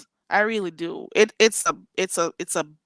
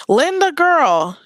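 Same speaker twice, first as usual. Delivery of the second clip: calling out; 8 semitones higher, 6 dB louder, slower than usual. Real, not acted. Calling out to a chat viewer.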